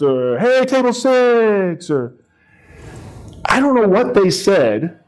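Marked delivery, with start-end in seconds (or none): 0.4-1.9 high, slow team chant; mock cheer. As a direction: stretch it out like a team calling out. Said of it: "would give a big cheer".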